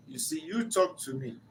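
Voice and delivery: usual speaking voice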